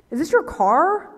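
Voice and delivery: high, slow, loud officer impression; questioning cop. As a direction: ask slowly with exaggerated suspicion. Voices the suspicious cop.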